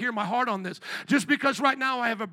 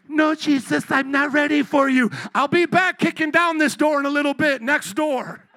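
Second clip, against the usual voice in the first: mock refusing sinner; 4 semitones higher, 7 dB louder than usual. Voicing the reluctant listener.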